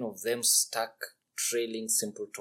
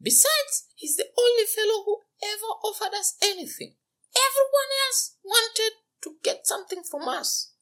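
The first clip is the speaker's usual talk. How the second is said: very high, delighted princess voice; cartoon princess; 6 dB louder than usual. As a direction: gush with giddy delight. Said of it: "gasping for air from laughter".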